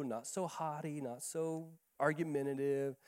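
ordinary talk, nothing marked

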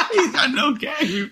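giggling through the repeat; laughing repeat. Real, not acted. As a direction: echo the line while cracking up.

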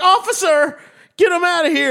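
high-pitched call for an officer; hammed-up appeal to the cops; mock plea to police. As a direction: cry out in mock alarm. Acts out calling the police.

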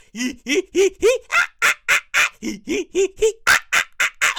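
high cackling hee hee ah ah; mocking sister laughter. Sisters laughing at him, high mocking cackle. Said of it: "I can hear my fucking sisters".

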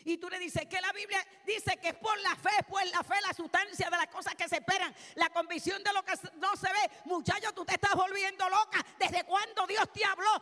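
loud, high, relentless pulpit shout; driving, shouted exhortation to the congregation; full-force preaching. Real, not acted. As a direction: preach it at the top of your voice.

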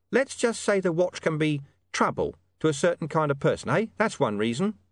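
high, cagey informant voice. Acted. Hidden informant hinting to William about the dog, high and cagey. Deep Bone explains distrust.

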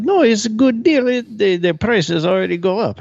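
high, loud Bulgarian accent impression; Eastern European accent. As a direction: imitate a Bulgarian woman's thick accent. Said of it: "she has a really thick Eastern European accent".

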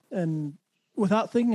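ordinary talk

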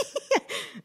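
light breathy laugh. Real, not acted. Laughs at her own stutter.

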